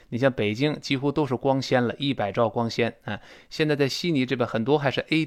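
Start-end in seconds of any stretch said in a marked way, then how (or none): none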